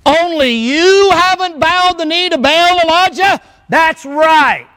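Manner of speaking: high, slow, puffed-up prophet voice; high and slow sneer of a self-important prophet; mock proud prophet. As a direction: lampoon his pride with a high put-on voice. Mocking Elijah's proud self-view.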